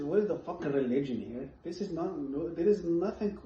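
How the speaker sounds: ordinary talk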